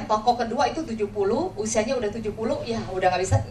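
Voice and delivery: ordinary talk, nothing marked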